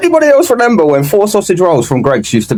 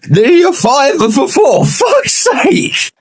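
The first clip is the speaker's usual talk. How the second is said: exasperated shout; 4 semitones higher than usual. Real, not acted. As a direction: shout it out, fed up. Ranting about sausage roll prices.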